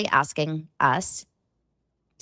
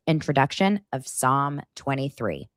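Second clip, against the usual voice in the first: normal talking voice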